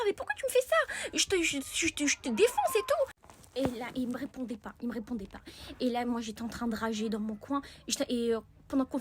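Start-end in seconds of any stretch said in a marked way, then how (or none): 0.0-3.1 high, sharp threatening retort; mock angry. Girl threatening the boy, mock anger. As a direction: snap back at him, half serious. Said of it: "je lui dis".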